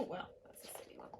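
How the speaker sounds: usual speaking voice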